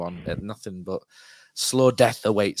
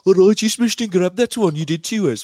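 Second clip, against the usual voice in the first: put-on West Brom accent; 8 semitones higher, 9 dB louder than usual. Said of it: "when they don't have the accent".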